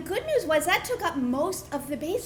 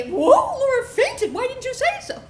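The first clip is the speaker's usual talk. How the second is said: much higher, louder alarmed family; 11 semitones higher, 6 dB louder than usual. Retold or imitated. Grandmother and aunts realizing the faint, alarmed, higher and louder. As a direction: gasp in sudden alarm.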